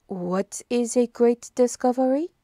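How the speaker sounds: mild surprise, Poirot voice; Poirot's dry query. A narrator's reading. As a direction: turn his claim back on him. Poirot questions Hastings.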